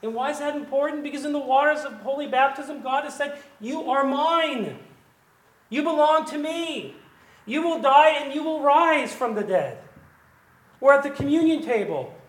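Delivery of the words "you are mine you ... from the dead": God's promise. Acted. Preacher voicing God's promise.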